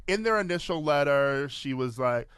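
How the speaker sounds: normal talking voice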